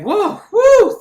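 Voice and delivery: high, loud whoa; hammed-up relief; relieved whoop. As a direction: exhale triumphantly. Relief after kill.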